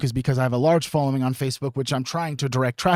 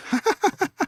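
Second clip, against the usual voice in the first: high giggle. Real, not acted. Laughs at own joke.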